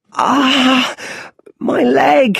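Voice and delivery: put-on high, loud pained child's cry; little Bruce hurt at the bottom of the well, a high loud cry. A narrator's reading. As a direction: yelp as the leg hits the floor. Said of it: "he cries".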